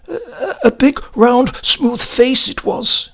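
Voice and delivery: a haunted witness recalling the face, high and slow; nervous driver. Retold or imitated. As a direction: stammer it out like a twitchy clerk. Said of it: "he told me".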